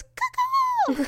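very high shouted caw-caw; answering crow call. Retold or imitated. Stranger answering the family call. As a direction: shout the bird call back. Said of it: "And somebody goes".

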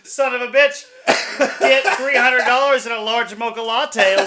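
hammed-up put-upon boss; mock exasperated manager. Manager at Starbucks reacts to the robbery call, mock exasperated.

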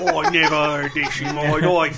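jokey, overdone Yorkshire grumble; bad Yorkshire accent. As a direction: do a badly faked Yorkshire accent. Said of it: "I really hope that's not even the accent".

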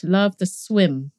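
normal talking voice

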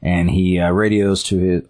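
ordinary talk

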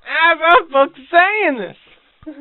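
slow, loud, high Southern twang; southern accent. Pretend. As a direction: ham up a Southern drawl, slow. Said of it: "Because I will sound southern".

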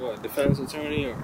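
ordinary talk

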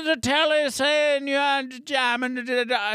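put-on high, bumbling English accent; badly faked British fading into nonsense syllables; failed English accent. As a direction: attempt English and give up. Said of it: "Yeah, I can't do English".

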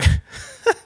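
squeaky high burst of laughter. Real, not acted. Laughing at how much work the Glee series was, high giggle. Laughing at her own recap.

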